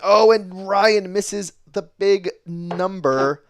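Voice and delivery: loud, slow, high exclamation of dismay; drawn-out commentator groan of excitement; excited call. Real, not acted. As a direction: call it out big as the dart misses. Commentator reacts to missed treble.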